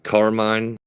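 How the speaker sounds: ordinary talk, nothing marked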